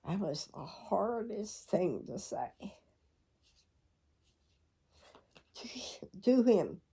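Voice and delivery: choked, ending in a whisper; real grief, trailing off into a whisper; fighting tears. Real, not acted. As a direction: hold back the tears as the voice goes. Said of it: "try not to cry".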